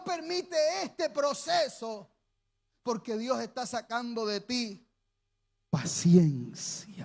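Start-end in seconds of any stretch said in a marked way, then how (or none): 5.7-7.1 much lower, very slow drawn word; slow solemn word. Real, not acted. Preacher teaching patience, low drawn-out word. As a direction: stretch it out low.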